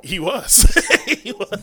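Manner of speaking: said through a laugh; laughing agreement. Real, not acted. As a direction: agree while cracking up. Laughs along with the joke.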